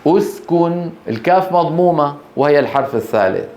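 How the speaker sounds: usual speaking voice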